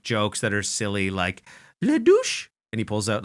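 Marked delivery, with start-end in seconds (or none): 1.8-2.5 very high falsetto French clown; high-pitched French voice. Retold or imitated. Clown making crass jokes to the audience, high-pitched French voice. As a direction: put on a piping French clown voice. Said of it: "to the audience in this high-pitched voice".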